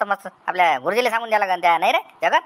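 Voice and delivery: ordinary delivery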